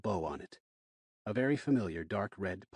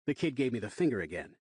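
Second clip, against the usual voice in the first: usual speaking voice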